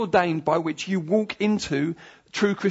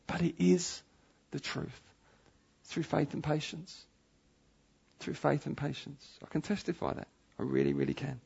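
much softer, earnest assurance; hushed testimony; 4 semitones lower, 10 dB quieter than usual. Real, not acted. Preacher testifying to faith and patience, hushed and sincere. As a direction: drop right down into quiet, sincere conviction.

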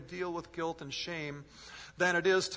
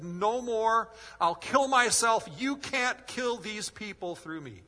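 desperate cry; 7 semitones higher, 6 dB louder, slower than usual. Retold or imitated. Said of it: "I fell to my knees and I said".

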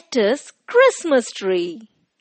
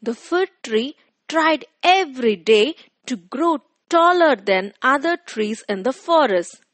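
ordinary delivery, nothing marked

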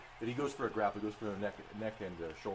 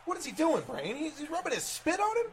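shrill confused wrestler; high, loud baffled squeal of a character; much higher and louder, puzzled and grossed out; 8 dB louder than usual. Acted. Wrestler confused at player's move.

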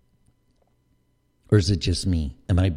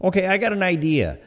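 higher-pitched mock ad pitch; eager pitchman; 8 semitones higher than usual. Marketer pitching the Aflac duck in a meeting, mock eager. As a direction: pitch the idea high, like an excited ad man.

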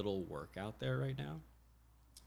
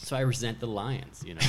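normal talking voice

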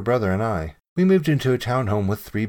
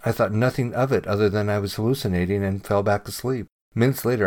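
ordinary delivery

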